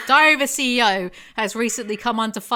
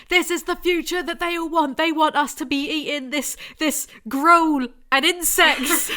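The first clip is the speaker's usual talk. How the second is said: raised pitch, indignant imitation of a conspiracy theorist; mock-outraged commenter; 7 semitones higher, 4 dB louder than usual. Podcaster quoting Facebook commenters on Huel, mock-outraged. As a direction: mimic an online alarmist, dragging out the last words.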